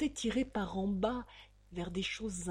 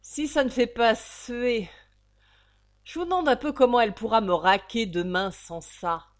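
louder, grumbling; grumbling landlady; 4 semitones higher, 10 dB louder than usual. Acted. The landlady grumbling contemptuously about the unpaid rent. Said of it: "haussa les épaules et grommela".

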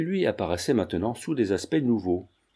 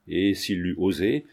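ordinary delivery, nothing marked

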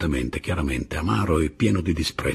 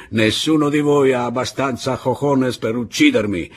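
higher-pitched, mocking dare; Pablo's scornful, raised taunt; 6 semitones higher, 5 dB louder than usual. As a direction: sneer at them in a raised voice.

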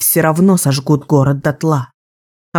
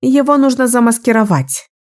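higher-pitched, eager to get to the point; impatient; 8 semitones higher than usual. A narrator's reading. Woman pressing the group toward the plan, impatient. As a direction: cut to the point on a raised pitch. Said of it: "не терпелось перейти к сути".

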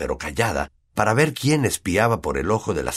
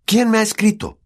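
high, loud question; demanding boy; 5 dB louder than usual. A narrator's reading. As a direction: press your uncle for an answer. Boy confronts uncle about letter.

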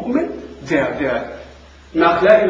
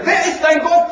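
shouted high question "wer ist dein Gott?"; a booming, shouted interrogation; angels shouting; 11 semitones higher, 3 dB louder than usual. Retold or imitated. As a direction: bellow it at the trembling dead man. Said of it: "fragen ihn noch einmal mit lauter Stimme".

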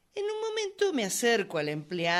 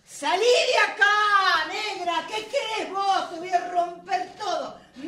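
furious outburst; 10 semitones higher, 8 dB louder than usual. Acted. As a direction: explode with rage at the clerk. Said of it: "La sangre hirviendo se me agolpó".

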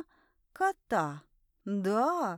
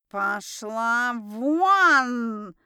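very slow, drawn-out roar of dismissal; furious yelling; 5 dB louder, much slower than usual. A narrator's reading. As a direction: roar it out, stretching each word. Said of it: "продолжая орать".

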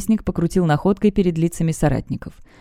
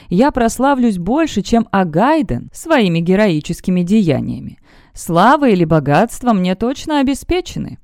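put-on old prospector; 3 semitones higher than usual. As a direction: read it as a greedy adventurer bragging. Reads the prospector's diary.

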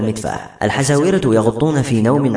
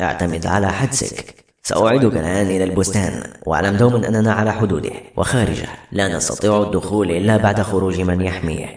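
low, grave figure with an altered timbre; cautioning otherworldly leader; 4 semitones lower than usual. Acted. Leader of the Majaheem cautioning Yusuf, grave and low. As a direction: warn him in a deep, measured voice.

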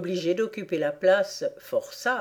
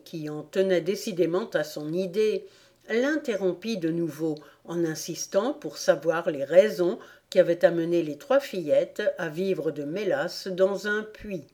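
ordinary delivery

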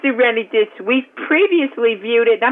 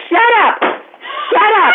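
yelling; 10 semitones higher than usual. Acted. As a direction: yell it at the kid twice. Mother yelling at child.

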